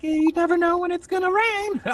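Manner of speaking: very high put-on child voice; little girl. Daughter explains boot and sandal to dad, childlike. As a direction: pipe it up like a small kid.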